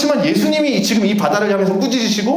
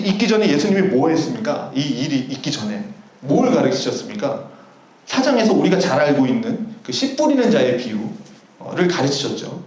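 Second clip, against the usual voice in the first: ordinary delivery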